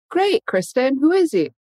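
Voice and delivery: put-on cheerful mom asking about boyfriend; mom's voice. Mom voiced in coming-out story.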